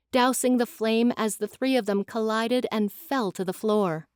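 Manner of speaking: normal talking voice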